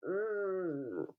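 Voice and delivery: humming mm with the nose pinched shut. Shown as a demonstration. Teacher demonstrates a pinched-nose hum. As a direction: hum through a blocked nose. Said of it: "Pinch your nose and say".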